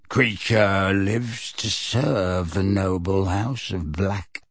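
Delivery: slow, low croaking elf voice; creaky house-elf. Acted. House-elf croaking to Sirius, slow and low. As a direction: bent old servant elf, croaking slowly.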